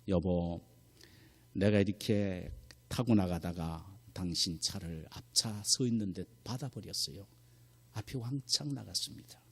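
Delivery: soft, low, hesitant retold wife; timid confession. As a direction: confess quietly to a spouse, acting it out. Wife confesses the crash by phone. (조심스럽게 털어놓는 아내 흉내)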